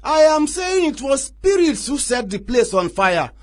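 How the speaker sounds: mock the police's excuses; sarcastic outburst. Chief mocking the police.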